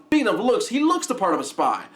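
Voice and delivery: normal talking voice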